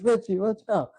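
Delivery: high-pitched imitated warning cry; mock alarmed warning. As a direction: call out a warning, hammed-up. Retold warning, repeated.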